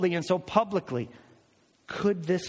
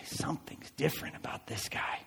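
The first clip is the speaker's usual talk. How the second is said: hushed, breathy wonder; whispered aside; 10 dB quieter than usual. Retold or imitated. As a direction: say it under your breath, amazed. Listener's amazed inner reaction.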